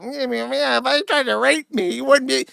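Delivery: high, squeaky mock anime-child voice; exaggerated high cartoon squeak; squeaky falsetto. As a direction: mimic her in a drawn-out squeaky falsetto. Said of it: "do the squeaky falsetto".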